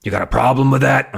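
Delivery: brash bully challenge; trying an Uncle Al voice. Acted. Host trying out a voice for Uncle Al, tough-guy put-on. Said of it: "I still don't have a voice for Uncle Al".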